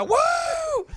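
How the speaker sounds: high, loud pretend cheer; mock celebratory whoop. Joining the pretend millionaire's celebration, mock whooping. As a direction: whoop it up like a jackpot winner.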